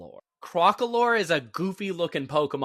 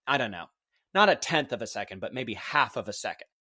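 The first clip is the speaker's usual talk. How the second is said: normal talking voice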